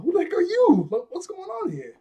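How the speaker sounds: squeaky mock pirate panic; startled pirates. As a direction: yelp it in shock at the stranger.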